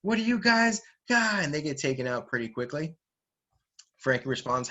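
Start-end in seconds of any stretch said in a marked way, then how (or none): 0.0-1.4 high, startled cartoon reaction; acted alarm and confusion; shocked disbelief. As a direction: blurt it out, pitched way up. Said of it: "react in shock and disbelief".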